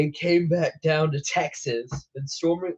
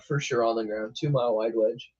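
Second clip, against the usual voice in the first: ordinary talk, nothing marked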